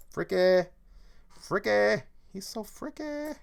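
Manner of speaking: high, loud squeal of freaky; mock freaky cry. Streamer mocks [name], squealing high. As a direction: squeal it, playing creeped out.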